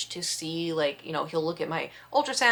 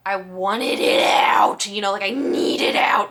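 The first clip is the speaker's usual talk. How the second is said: urgent; 7 dB louder, slower than usual. Real, not acted. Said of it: "emphatic desperation".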